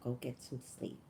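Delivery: soft, tired-sounding sister telling her to go home; soft sister's voice. Retold or imitated. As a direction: quietly repeat the sister's gentle order. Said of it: "And she goes".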